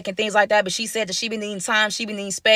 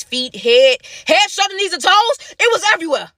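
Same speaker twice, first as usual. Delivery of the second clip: rising comic panic; 8 semitones higher, 4 dB louder than usual. Pretend. Stomach-drop joke escalating.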